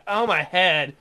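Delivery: normal talking voice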